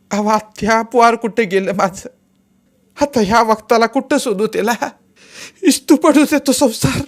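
put-on high, loud anguished wail for her lost child; wailing lament. Mother wails to aunt about her missing son, loud anguished lament. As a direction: cry out in despair, voice rising. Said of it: "हंबरडाच फोडला तिनं".

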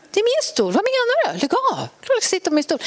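high, indignant retort; acted-out snippy protest, high pitched; nasty tone. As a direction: snipe back in a mock-petty high voice. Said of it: "Otroligt taskigt tonfall".

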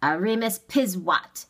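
mildly scornful dismissal. A narrator's reading. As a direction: toss out the phrase with scorn. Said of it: "scornful phrase".

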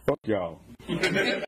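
ordinary talk

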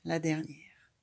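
ordinary delivery

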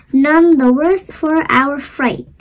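ordinary delivery, nothing marked

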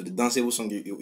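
usual speaking voice

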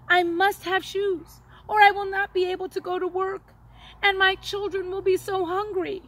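high, tearful pleading, voice breaking; a father on the edge of tears; near tears. A narrator's reading. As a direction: close to crying over his children. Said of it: "looked like he was going to cry".

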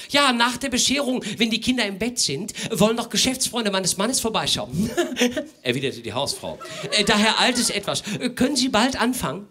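high, giggly housewife voice; a nervous, laughing lady of the house; flustered hostess. Acted.

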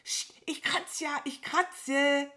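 high, squeezed crow voice; frantic placating. Acted. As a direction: hush and give in frantically. Crow frantically placates the itchy cow. (hektisch und gepresst beschwichtigen)

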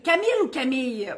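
Camille first with a clear final l, then Camille ending in a y sound; two ways to say Camille. Shown as a demonstration. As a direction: say Camille as kamil, then as kamij. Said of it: "On sait pas si c'est un gars ou c'est une fille".

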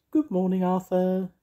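high, loud fairy trill; piercingly high trilled call. A narrator's reading. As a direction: sing out a shrill cheery greeting. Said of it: "trilled Mavis".

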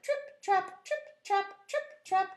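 high, light trip-trap rhythm; hoofbeat imitation. Little goat crossing the bridge, light hoofbeat imitation. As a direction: patter along like small hooves.

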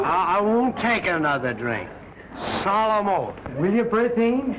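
tipsy pleading; a mildly drunk promise. Acted. Tipsy suitor swearing off drink to Bertine. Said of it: "but you're tight".

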